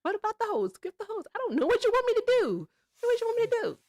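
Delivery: high-pitched sassy retort, fast at the end; mock exasperated reply. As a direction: fire it back in a squeaky sass. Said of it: "I was like".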